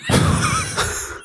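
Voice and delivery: breathy squeal of laughter; high unvoiced giggle. Real, not acted. Cashier laughs after pledge.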